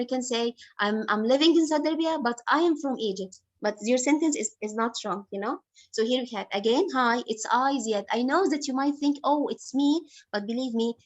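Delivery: usual speaking voice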